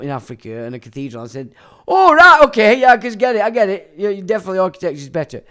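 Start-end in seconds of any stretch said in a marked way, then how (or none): 1.9-5.4 high, loud fake agreement, speeding up at the end; loud sarcastic "oh right, I get it"; facetious. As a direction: gush mock agreement dripping with sarcasm. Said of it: "I were being fucking facetious".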